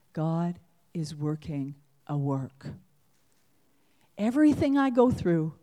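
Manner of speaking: ordinary talk, nothing marked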